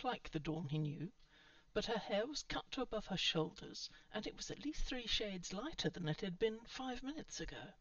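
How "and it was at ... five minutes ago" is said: ordinary delivery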